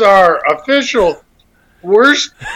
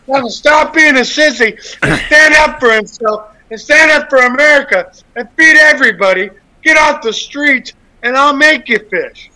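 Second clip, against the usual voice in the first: higher, hammed-up pep-talk rant; comic rant at listeners, higher and pushed; mock rant; 7 semitones higher than usual. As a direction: bark it like a joking drill sergeant. Mock drill-sergeant rant.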